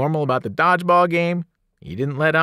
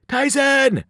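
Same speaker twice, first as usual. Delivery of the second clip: high cry of alarm; screamed name; 12 semitones higher, 4 dB louder than usual. A narrator's reading. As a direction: scream out to a friend in danger. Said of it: "I screamed".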